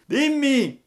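loud, high, long-drawn call; shouted from high above. A narrator's reading. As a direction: call down from a great height, stretching the words. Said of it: "gli gridò".

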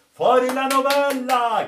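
high, loud shouted demand; shouting. Acted. The whole crew shouts at the sailor for his story. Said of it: "gridarono tutti".

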